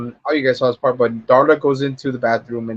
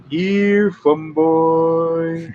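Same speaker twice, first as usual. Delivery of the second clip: drawn-out, higher-pitched call; long taunting summons, pitched up; sing-song taunt; 4 semitones higher, much slower than usual. Retold or imitated. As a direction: call him out slowly, holding the last word. Acts the crow calling Fun Boy.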